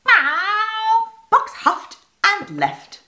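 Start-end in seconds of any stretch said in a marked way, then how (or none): 0.0-1.1 high huffy meow; a cat's offended meow. A narrator's reading. Cat meows.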